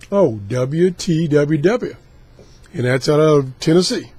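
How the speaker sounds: normal talking voice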